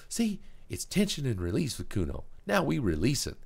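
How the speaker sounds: put-on high, loud cocky hustler kid; pushy punk kid hawking his goods; Kuno sales pitch. Kuno selling drugs.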